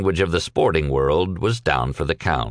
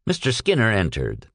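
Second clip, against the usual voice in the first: usual speaking voice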